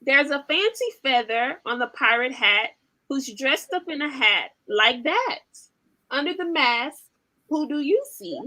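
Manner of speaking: lively, sing-song storybook reading; animated picture-book read-aloud; reading to children. Acted. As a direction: read it like bedtime for toddlers.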